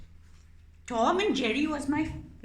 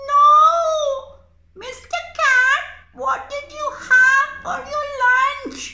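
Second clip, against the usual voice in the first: much higher, loud singsong question; singsong squeaky mouse; 12 dB louder than usual. Acted. Mouse puppet sweetly stalling the cat, singsong squeak.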